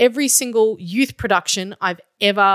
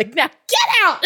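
higher, half-laughing mock yell; laughing shout; 10 semitones higher than usual. Students shout at each other leaving rehearsal, laughing mock yell. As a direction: kids yelling it at each other, played for fun. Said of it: "they shout at each other".

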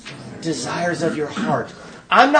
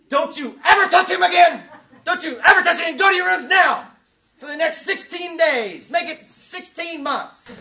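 hammed-up father yell; 13 semitones higher than usual. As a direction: play the overblown angry dad. Father scolding the boys.

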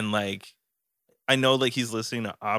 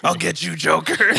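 loud hammed-up hero threat; superhero voice; 6 semitones higher, 7 dB louder than usual. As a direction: growl it like a comic-book hero. Jokey superhero line.

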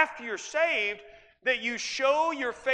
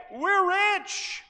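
mock boast; 11 semitones higher, 4 dB louder than usual. Mocking boastful lukewarm church.

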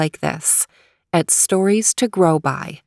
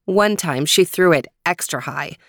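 usual speaking voice

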